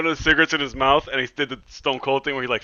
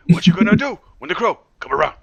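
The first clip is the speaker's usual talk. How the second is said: raised, loud rap-style challenge; mock rapper Crow; 6 semitones higher, 7 dB louder than usual. Friend imagining rapper as the Crow, mock challenge. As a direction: throw it out like a rapper's taunt.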